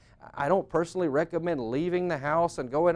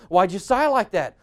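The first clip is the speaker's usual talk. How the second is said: loud, pitched-up mock suspicion; accusing question; 4 semitones higher, 8 dB louder than usual. Spouse challenging a supposed sigh, loudly accusing. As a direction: demand it, touchy and accusing. Acted quarrel over a sigh.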